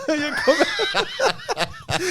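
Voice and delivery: laughing at how long ago it was. Real, not acted. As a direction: laugh through the words, stretching them out. Laughing while recalling the bac.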